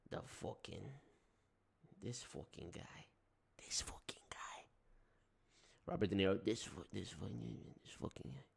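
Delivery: whispered New York grumble. Pretend. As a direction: hiss it under your breath, no voice. Whispered mobster muttering.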